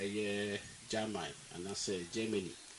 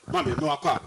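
calling out; 5 semitones higher, 8 dB louder than usual. Real, not acted. Arriving at the office, calling out.

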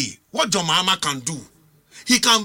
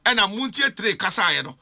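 ordinary delivery

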